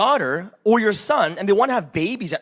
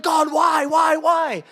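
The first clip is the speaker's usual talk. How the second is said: pleading outcry; 5 semitones higher, much slower than usual. Retold or imitated. Crying out in difficult time.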